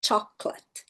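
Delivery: "chocolate" reduced to CHOC-let; English pronunciation. Shown as a demonstration. Teacher showing the shortened English word, two-syllable "chocolate". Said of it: "em inglês ela diminuiu pra duas sílabas".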